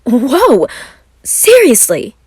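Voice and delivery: startled, loud whoa; amazed surprise. Acted. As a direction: gasp it out in disbelief. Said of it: "Roman's eyes opened wide".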